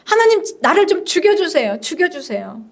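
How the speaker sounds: high-pitched plea, falling away at the end; desperate prayer. Acted. Jonah begging God to end his life, desperate prayer. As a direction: beg to be let die. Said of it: "이게 이제 요나의 기도였습니다".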